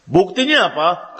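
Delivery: ordinary talk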